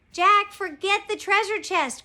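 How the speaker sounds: loud, high-pitched urgent order; exasperated storybook cry. A narrator's reading. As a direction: shout to pull a friend away. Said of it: "cried Annie".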